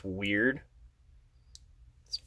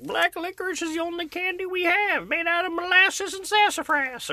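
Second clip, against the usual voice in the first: high, old person impression; lightly voiced old grandma. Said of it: "this is the best old person voice".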